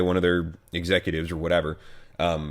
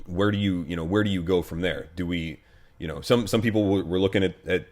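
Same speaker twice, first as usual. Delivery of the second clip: ordinary talk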